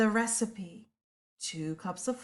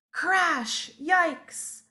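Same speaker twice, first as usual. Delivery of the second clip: startled yikes; 7 semitones higher than usual. Acted. Monkey slips and crashes.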